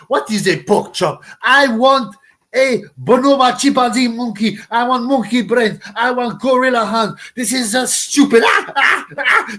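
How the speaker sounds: loud, high mock rant demanding food; mock angry boxer. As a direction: ham it up as the furious fighter yelling for meat. Mocking impression of a boxer demanding food.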